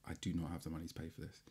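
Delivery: low, fast, very soft; retold hushed realisation of trouble; worried private thought. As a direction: mutter it quickly to yourself. Teller's worried thought watching meter.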